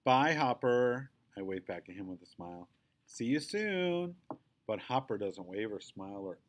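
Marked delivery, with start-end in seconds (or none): 0.0-1.1 louder, slow call. A narrator's reading. Girl calling goodbye to Hopper, slow and cheerful. 3.0-4.1 higher, slow, sing-song; sweet, drawn-out farewell; sing-song goodbye. A narrator's reading. Girl calling to Hopper, drawn-out and sweet.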